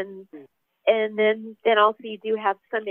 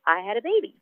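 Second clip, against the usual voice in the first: normal talking voice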